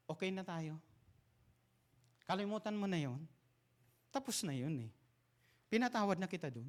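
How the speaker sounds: quiet, offhand reassurance; soft dismissive forgiving. Acted. Someone telling an offender it is forgotten, soft and offhand.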